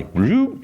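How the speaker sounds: a quick rising whoop sound effect; cartoon vanishing whoop. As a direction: swoop the voice up for a vanishing.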